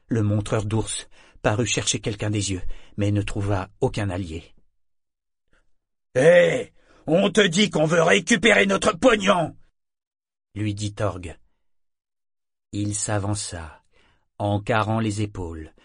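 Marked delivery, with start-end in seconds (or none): 6.1-9.5 loud, high confrontational shout; angry demand. Acted. Brute demands the crowd's money from the trainer, angrily. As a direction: challenge him loudly, voice pitched up.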